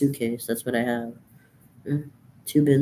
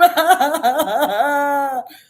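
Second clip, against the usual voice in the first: a shrieking burst of laughter; high loud laugh; 7 dB louder than usual. Real, not acted. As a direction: let out a high cackle. Laughing after parody.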